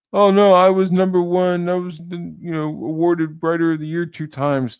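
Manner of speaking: high, loud mocking voice of the writer; mocking whiny boast. Mocking the comic writer's boast of awards, high and loud. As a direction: mimic him bragging in a high, loud sneer.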